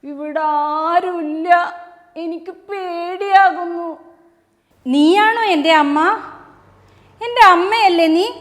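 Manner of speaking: high, frightened chick pleading for its mother; scared little chick. Acted. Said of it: "കോഴിക്കുഞ്ഞ് എന്താ പറഞ്ഞെന്നറിയോ?".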